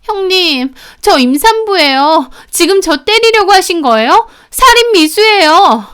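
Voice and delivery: high-pitched snide sister-in-law; needling. Acted. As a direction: needle them with mock alarm. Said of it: "동서가 깐죽거리며 그러더라고요".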